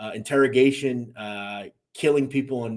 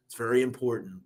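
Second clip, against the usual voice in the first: normal talking voice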